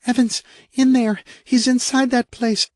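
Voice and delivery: high, anguished woman calling for her husband; a wife frightened for him; distraught wife. Acted. Kay voiced in distress.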